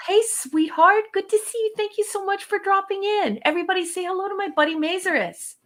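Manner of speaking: high-pitched, sweet gushing welcome; affectionate greeting. Real, not acted. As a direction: coo hello to a dear friend. Greets a friend who dropped in.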